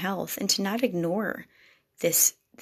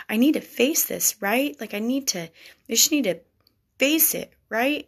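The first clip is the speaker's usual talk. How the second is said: higher, louder, pressing for agreement; urgent insisting; 5 semitones higher, 4 dB louder than usual. Acted. Wife insisting to her husband she must face it, higher and louder. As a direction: push hard, trying to convince yourself.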